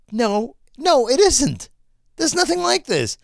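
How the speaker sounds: high, insistent denial; exasperated contradiction. Retold or imitated. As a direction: push back in rising frustration. Retells arguing with case sellers.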